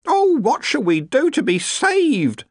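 high, drawn-out wail of a cook; panicked outburst. A narrator's reading. The cook hears of fish complaints, panicked outburst. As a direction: burst out high in hammed-up panic. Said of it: "the cook burst out".

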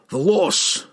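high-pitched cry of grief; anguished lament. A narrator's reading. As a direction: grieve aloud in delirium. Delirious dwarf relives the disaster.

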